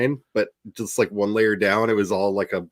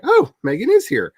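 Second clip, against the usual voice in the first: mock surprise; 4 dB louder than usual. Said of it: "I was like".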